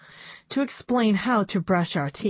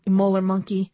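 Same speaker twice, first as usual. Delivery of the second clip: plain reading voice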